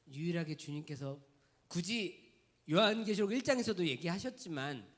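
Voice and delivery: ordinary talk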